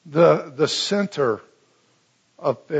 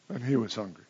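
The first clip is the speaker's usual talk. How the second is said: quiet wry deadpan; deadpan repeat; 6 semitones lower, 8 dB quieter, faster than usual. Shown as a demonstration. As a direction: let the irony land softly. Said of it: "understatement of the gospel writers".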